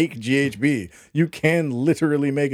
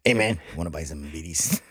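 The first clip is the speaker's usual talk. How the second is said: shady dealer; 9 semitones lower, 5 dB quieter than usual. Pretend. Shady bead dealer pitch.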